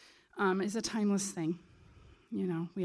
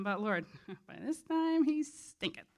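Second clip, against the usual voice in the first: breaking into a chuckle while reading; reading it out, tickled by it; amused; 5 semitones higher than usual. Retold or imitated. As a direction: laugh through the quoted line. Amused while reading Martha's line.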